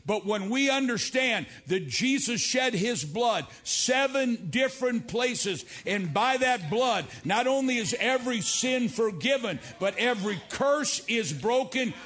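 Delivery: high, loud sung preaching. Real, not acted. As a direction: lift it into a chant. Preaching at full force.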